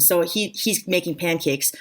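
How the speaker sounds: normal talking voice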